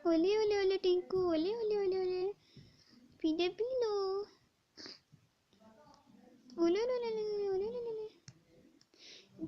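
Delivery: put-on soft sing-song cooing to a baby. Naina coos softly to baby Tinku while feeding him. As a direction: coo gently to calm the baby.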